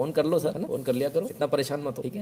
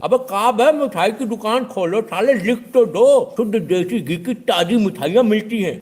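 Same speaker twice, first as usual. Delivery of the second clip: much higher and louder, slow exasperated yell; comic outraged tirade; exasperated shout; 9 semitones higher, 8 dB louder, slower than usual. Retold or imitated. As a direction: yell like you've lost all patience. Know-it-all demands full sign back.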